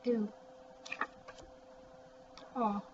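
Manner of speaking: ordinary talk, nothing marked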